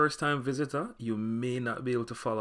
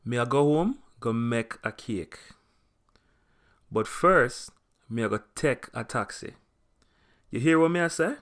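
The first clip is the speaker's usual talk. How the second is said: lively Jamaican Patois, louder; 4 dB louder than usual. Shown as a demonstration. Teacher demonstrating Patois conversation to learners, with a Jamaican accent. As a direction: chat it like a Jamaican in a real conversation. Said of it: "an example of them being used in conversation".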